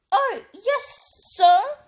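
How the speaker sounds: high, loud, earnest elf voice; eager elf pleading earnestly; earnest house elf. A narrator's reading. Elf answers Harry earnestly, high and loud. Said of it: "said Dobby earnestly".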